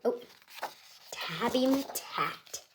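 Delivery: usual speaking voice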